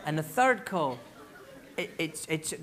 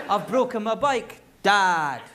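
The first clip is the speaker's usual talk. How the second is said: business-like call; 7 dB louder, slower than usual. Shown as a demonstration. Child telling dad about a bike, business-like. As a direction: report the problem, then call out squarely. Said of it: "'Cause it's a business call".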